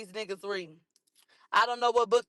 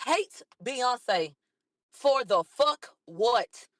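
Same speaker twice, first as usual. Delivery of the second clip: slow, punched "for the fuck what", each word set apart; drawn-out, indignant stress on "fuck what"; incredulous emphasis; much slower than usual. Real, not acted. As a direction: break before "for", then hit "fuck" and "what" separately. Indignant repeat of rejected accusation.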